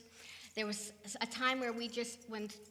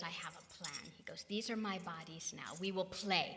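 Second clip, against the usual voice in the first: softer, low-key imitation of Swami; hushed Swami voice; 7 semitones lower, 6 dB quieter, faster than usual. Retelling Swami's soft plan, hushed. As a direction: murmur it gently as Swami.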